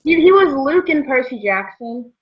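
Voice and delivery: ordinary delivery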